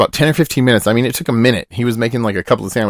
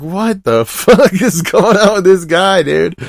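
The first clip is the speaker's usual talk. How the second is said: higher, slow, laughing disbelief; incredulous laughing outburst; 6 semitones higher, much slower than usual. Retold or imitated. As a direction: laugh it out in total disbelief, pitch way up. Baffled by the sandwich worker.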